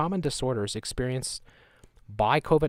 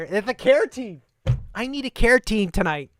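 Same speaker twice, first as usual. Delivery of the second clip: mock gushing; 9 semitones higher, 7 dB louder than usual. Mocking the care team ad.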